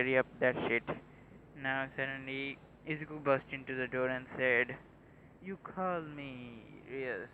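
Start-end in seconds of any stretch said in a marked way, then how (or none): 5.4-7.3 unimpressed. Acted. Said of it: "with a un-, unimpressed voice".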